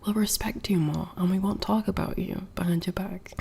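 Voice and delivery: breathy whispered reading of a chat line. Acted. Streamer whispering a viewer's comment aloud, hushed. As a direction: hush it like a secret promise.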